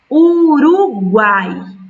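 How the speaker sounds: "Uruguai" dito sílaba por sílaba, devagar e mais alto; syllable split. Shown as a demonstration. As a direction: separe "Uruguai" em u-ru-guai, bem devagar. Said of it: "Próxima". ("Uruguai" escandido sílaba a sílaba)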